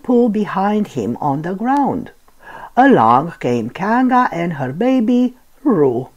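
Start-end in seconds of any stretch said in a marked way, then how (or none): none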